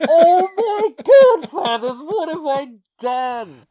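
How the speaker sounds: high, slow, wailing through laughter; laughing mock horror. Retelling his blunder to the host, wailing mock despair.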